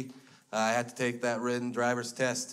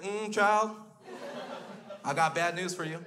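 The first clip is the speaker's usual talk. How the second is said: a hint of a sassy DMV lady, pitched up; sassy clerk; 4 semitones higher than usual. DMV clerk breaking bad news, lightly sassy voice.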